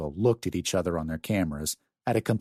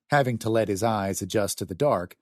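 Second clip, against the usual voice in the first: ordinary delivery, nothing marked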